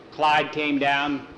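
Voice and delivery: usual speaking voice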